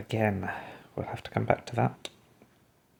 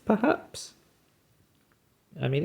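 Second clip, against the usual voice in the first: normal talking voice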